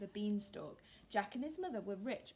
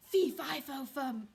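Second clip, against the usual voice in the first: loud giant chant; booming giant; 2 semitones higher, 9 dB louder, slower than usual. Acted. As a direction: call it out like a giant. Giant arrives sniffing.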